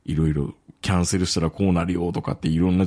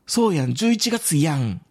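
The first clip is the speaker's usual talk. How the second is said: Kansai chat line read higher; quoted Osaka dialect; 8 semitones higher than usual. Retold or imitated. Reading a coworker's chat message, mock Osaka dialect. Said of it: "大阪弁喋るんですよ".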